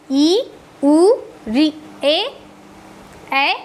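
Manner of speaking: ई, उ, री, ए each held for two beats; long vowels. Shown as a demonstration. Teacher to class, demonstrating long vowels, each drawn out. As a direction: stretch every vowel to double length. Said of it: "दीर्घ स्वर में थोड़ा ज़्यादा समय लग रहा है".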